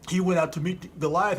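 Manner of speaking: ordinary talk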